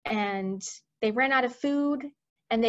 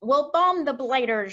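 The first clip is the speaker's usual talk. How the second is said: raised, louder military cry; shouting; 4 semitones higher, 6 dB louder than usual. Acted. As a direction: call for attack like an air chief. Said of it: "shouted the head of the Air Force".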